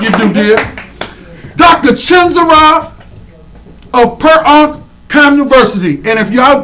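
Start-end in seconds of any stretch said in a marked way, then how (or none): none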